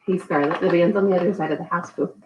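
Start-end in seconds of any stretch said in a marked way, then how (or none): none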